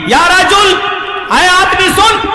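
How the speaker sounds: high, loud call across the street; high, loud shout to a man walking off. Acted. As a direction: shout to get his attention. Umar calls out again.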